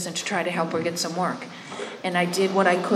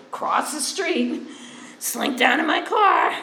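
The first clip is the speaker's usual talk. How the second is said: high, slow, drawn-out mock sneaking; mock furtive; 6 semitones higher, slower than usual. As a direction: play up dodging people, stretching it out. Mimicking her old habit of hiding.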